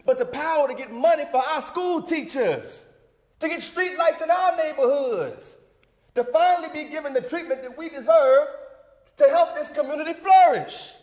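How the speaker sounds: building to a high, loud climax; impassioned oratory. Acted.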